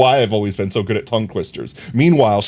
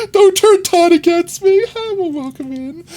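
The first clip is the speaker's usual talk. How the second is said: mock plea; 4 dB louder than usual. Playful plea to chat.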